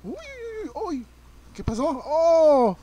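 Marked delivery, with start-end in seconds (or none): 0.0-1.0 high squeaky startled yelps; jumping at a sudden enemy. Real, not acted. Gamer startled by a monster, yelping in fright. 2.0-2.8 loud, high sudden oh; startled shout. Real, not acted. Player hit by surprise, shouting out loud.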